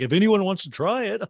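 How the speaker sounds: high, loud, laughing quote; Kathy. Retold or imitated. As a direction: offer it with a mock-giddy lift. Acting out Kathy, then cackle.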